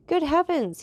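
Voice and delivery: sudden high, loud cry of alarm; a steward suddenly recognising someone, crying out; startled exclamation. Acted. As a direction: blurt it out in shock. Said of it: "he exclaimed".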